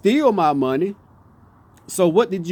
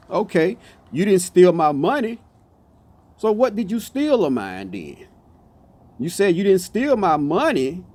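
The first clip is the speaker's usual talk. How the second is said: usual speaking voice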